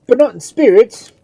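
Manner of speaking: high, very loud concession; agitated priest's shout; bitter retort. A narrator's reading.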